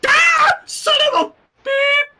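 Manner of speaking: high, loud yelp of pain; shrieking curse from the burn; pained shout. Acted. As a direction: yell as the hot milk burns. Burned wrist with hot milk.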